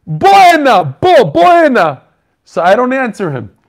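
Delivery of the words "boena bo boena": loud, higher call of "Boena", slowed and drawn out. Retold or imitated. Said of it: "comes, he goes".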